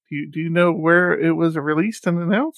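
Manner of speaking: ordinary talk, nothing marked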